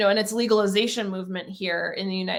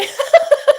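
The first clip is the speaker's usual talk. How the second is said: high, loud burst of laughter; delighted laugh; 11 dB louder than usual. Real, not acted. Host celebrating her first NFT, delighted laughing.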